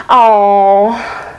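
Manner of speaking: drawn-out, put-on pity; mock a sympathetic cliché, stretching each word; unnatural sympathy. Shown as a demonstration. Speaker trying a phrase that isn't natural for them, stilted sympathy. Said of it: "It doesn't even sound natural coming from me".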